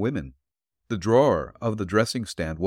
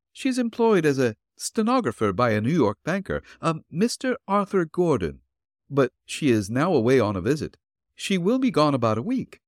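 put-on higher, gentle old woman's voice; elderly landlady voice; 5 semitones higher than usual. Landlady answering the detective at her door, politely.

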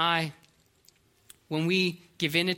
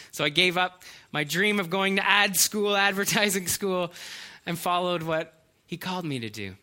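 usual speaking voice

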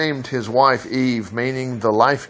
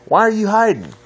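raised calling voice; 9 semitones higher than usual. A narrator's reading. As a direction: call out across the garden. The Lord God calls to Adam.

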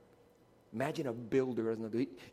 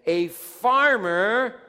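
loud drawn-out call; 9 semitones higher, 15 dB louder, much slower than usual. Real, not acted. Pastor calling to the congregation for an answer, loud and stretched. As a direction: stretch "farmer" out loud and high, inviting the answer.

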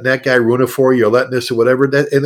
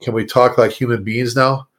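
calm scolding. Retold or imitated. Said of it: "He go".

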